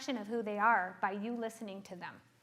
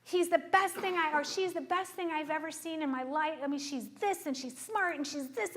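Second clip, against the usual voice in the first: high, eager infatuated gush; gushing client; 6 semitones higher than usual. Retold or imitated. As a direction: rave about a new crush. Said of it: "they're like".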